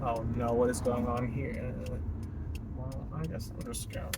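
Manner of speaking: low, very soft, sinking near a whisper; hushed aside. Acted. Driver acting out puzzled onlooker slipping away, hushed low aside. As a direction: shrink away quietly to yourself.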